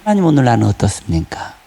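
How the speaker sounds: low, unhurried talk with God; conversational prayer. Acted. Preacher modelling prayer as communion with God, low conversational prayer. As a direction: confide in God, pitch dropped low. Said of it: "이게 기도라는 거예요".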